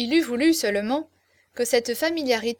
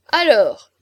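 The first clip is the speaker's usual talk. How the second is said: loud cry for attention; shouting; 10 dB louder than usual. A narrator's reading. Chaverny to the guests who won't let him speak, shouting. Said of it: "cria Chaverny".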